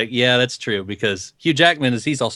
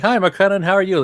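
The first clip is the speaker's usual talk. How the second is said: high-pitched, chirpy hello; chirpy greeting; 8 semitones higher than usual. Acted. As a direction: greet them in a high, put-on voice. Acting out a cheery greeting.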